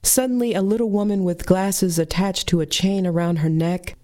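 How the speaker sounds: ordinary delivery, nothing marked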